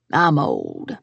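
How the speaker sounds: put-on very low croaking old man; grumpy old man. A narrator's reading. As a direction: drop deep, a lazy old man excuse. Grandpa Mo speaks.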